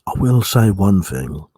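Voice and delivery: put-on very low, close-up drunken ASMR voice.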